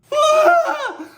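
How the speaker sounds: very high, loud shrieking laugh; wild hysterical cackle. Pretend. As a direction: burst into a manic shriek of laughter. Hysterical burst before quote.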